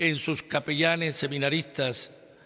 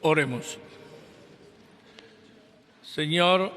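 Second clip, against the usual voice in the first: solemn prayer; 2 semitones higher than usual. Acted. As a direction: intone it like a priest at Mass. Sacerdote reza la oración final. (oración solemne entonada)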